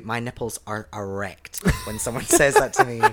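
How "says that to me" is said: laughing. Real, not acted. Laughing while bragging.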